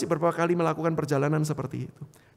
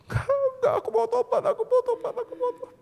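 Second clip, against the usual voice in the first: very high squeaky crying plea; retold wailing. Husband denying illness to his wife, acted tearful wail. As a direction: act a tearful wail, fading at the end. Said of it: "sambil nangis".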